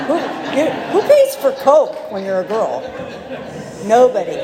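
amused, laughing punchline. Real, not acted. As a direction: toss it out while laughing. Joking about paying for coke.

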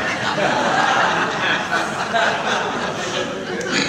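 a real, airy chuckle. Real, not acted. Laughing at own remark.

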